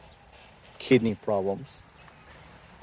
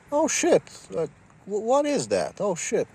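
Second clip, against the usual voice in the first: shocked reaction; 7 semitones higher, 4 dB quieter than usual. Retold or imitated. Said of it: "And my reaction would be like".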